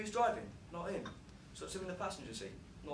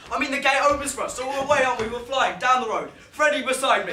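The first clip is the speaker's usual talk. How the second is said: loud, higher, thrilled; excited; 6 semitones higher, 10 dB louder than usual. Acted. Driver reliving the joyride, loud and thrilled. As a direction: ride the rush of the getaway.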